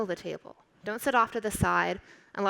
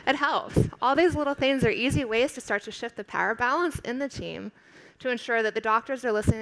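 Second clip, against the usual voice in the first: ordinary delivery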